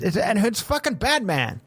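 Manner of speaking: usual speaking voice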